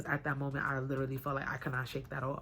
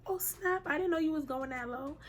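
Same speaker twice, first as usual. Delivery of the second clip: much higher, amazed voice of the hair colorist; surprised lady; 13 semitones higher than usual. Retold or imitated. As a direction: gasp it like a startled woman on the street.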